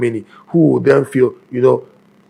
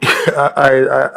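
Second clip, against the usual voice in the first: stifled laugh on the words; holding back a giggle; trying not to laugh; slower than usual. Real, not acted. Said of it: "I'm trying not to laugh".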